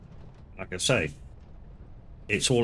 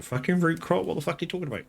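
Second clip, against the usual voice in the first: mock-incredulous viewer voice, higher; imitating scoffing viewers; 9 semitones higher, faster than usual. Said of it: "some of you are gonna go".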